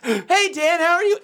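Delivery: high, perky mock greeting; exaggerated high-pitched friendliness; chirpy morning greeter. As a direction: imitate an annoyingly cheerful hello. Imitates cheery morning greeter.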